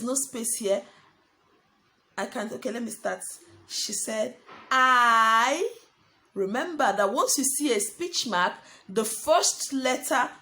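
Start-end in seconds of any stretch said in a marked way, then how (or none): 4.7-5.7 loud, long-held "I" rising at the end; dictating while writing. Real, not acted. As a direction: stretch "I" out as you write it.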